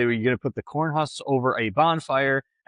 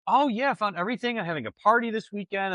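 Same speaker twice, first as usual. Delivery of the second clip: high, eager chatty voice; bubbly over-sharing shopper; chatty customer; 7 semitones higher than usual. Retold or imitated. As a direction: gush like a customer who loves to talk.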